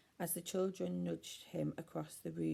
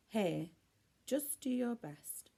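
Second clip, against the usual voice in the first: encouraging; 6 semitones higher, slower than usual. A narrator's reading. Lucy encouraging Norman.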